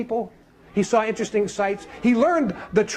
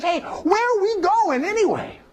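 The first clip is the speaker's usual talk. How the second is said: lightly voiced, slow, higher stranger; curious question; 7 semitones higher, slower than usual. Last person in line asking the way, slow and wondering. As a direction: ask slowly, wondering.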